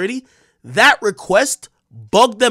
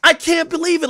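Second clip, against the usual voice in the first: upset disbelief; 11 semitones higher, 4 dB louder than usual. Real, not acted. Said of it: "I'm so upset".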